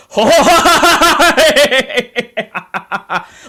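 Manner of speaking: high, loud, booming cackle; hearty pirate laugh. Retold or imitated. Pirate crew laughing after the bandit leaves, loud high cackle. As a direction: let out a big crew laugh. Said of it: "like, that kind of laugh".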